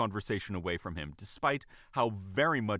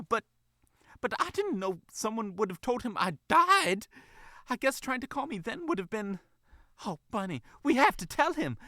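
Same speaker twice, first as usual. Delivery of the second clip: distressed fox mother; 13 semitones higher than usual. Acted. Fox mother begging the bunny to fix this, distressed. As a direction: plead in a high voice, rising to insistence.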